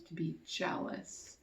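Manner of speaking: soft whisper; quiet whisper about a hard feeling. Real, not acted. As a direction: hush it gently. Teacher softens.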